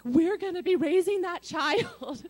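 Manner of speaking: high, loud and slow, near tears with excitement; a high, loud, drawn-out cry of conviction; overexcited outburst. Retold or imitated. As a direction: blurt it out, wildly certain and overwhelmed. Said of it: "you look like a crazy person right now".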